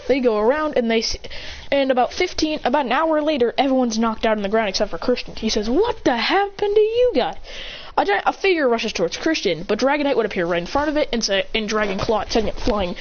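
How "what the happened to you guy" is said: high, drawn-out disbelief; startled exclamation. Acted. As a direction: exclaim at the sight, pitched up. Finds friends knocked out.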